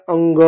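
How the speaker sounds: "अंग" drawn out with a held "ng"; isolated, lengthened "अंग" for pupils; demonstrated nasal vowel. Shown as a demonstration. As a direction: sound out "अंग" slowly, stretching the nasal. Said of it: "क्या बोलते हैं".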